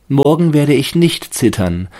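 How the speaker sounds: hohe, lautere, gläubige Baumstimme; Stimme des Baumes. A narrator's reading. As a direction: als staunender Tannenbaum. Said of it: "dachte er". (hohe, überzeugte Baumstimme)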